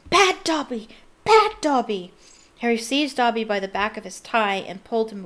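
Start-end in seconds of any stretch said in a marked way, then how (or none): 0.1-2.1 high, loud squealing cry. A narrator's reading. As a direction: punish himself with shrill self-scolding squeals.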